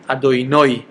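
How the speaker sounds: "Adonai" said as Adonoy, ending in oy. Shown as a demonstration. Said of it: "make sure to pronounce it".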